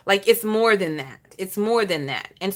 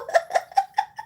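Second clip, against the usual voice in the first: soundless breathy chuckle; trailing laugh after a greeting; airy laugh. Real, not acted. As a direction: let the laugh out on air only.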